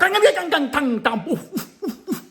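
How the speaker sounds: high burst of nonsense syllables; mock foreign gibberish. Inmate pranking officer at mail call, high mock gibberish. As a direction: imitate a made-up language, high and ringing. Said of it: "and the dude spoke".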